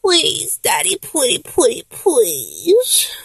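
soft, high imitation of cutesy begging; small, drawn-out pretty-please voice; little pleading voice. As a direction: mimic a babyish wheedle, stretching the end. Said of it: "the way you do that".

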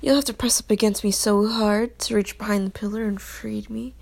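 ordinary delivery

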